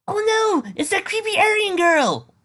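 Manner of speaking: very high, loud panicked shriek, stretched slow; frightened yell. A narrator's reading. Fleeing boy yelling in fright at the girl. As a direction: scream it like a fleeing kid. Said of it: "one voice yelled".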